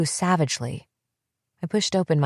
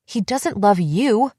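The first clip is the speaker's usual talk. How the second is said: higher, slow, mild yell; yelling back; 4 semitones higher, 4 dB louder, slower than usual. A narrator's reading. Narrator yelling back at her sister, angry. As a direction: throw it back at her hard. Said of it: "I yelled back".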